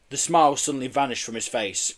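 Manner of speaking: usual speaking voice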